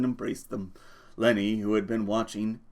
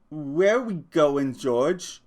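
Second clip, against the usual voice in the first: slow plaintive; 5 semitones higher, 4 dB louder, much slower than usual. Acted. Lenny asks slowly.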